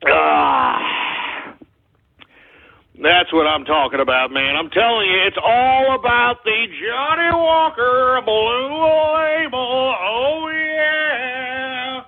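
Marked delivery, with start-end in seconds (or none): 0.1-1.0 long, low exhale after a drink; satisfied "ahh". Real, not acted. Host after a swig of scotch, satisfied exhale. 5.3-12.0 slow, high, drawn-out crooning; gleeful relish. Real, not acted. Host savoring his Johnny Walker Blue, gleeful.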